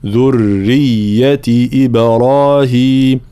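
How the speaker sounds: slow chant, doubled r and y in "ذرية"; long held vowels in "إبراهيم", doublings clear in "ذرية"; recitation model. Shown as a demonstration. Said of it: "هكذا". (ترتيل ذرية ببيان التشديد)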